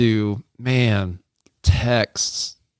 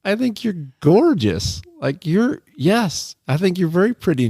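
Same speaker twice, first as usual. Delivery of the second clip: earnest reassurance; 8 semitones higher than usual. Retold or imitated. Retold reassurance to wife.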